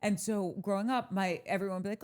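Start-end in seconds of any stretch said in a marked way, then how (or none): none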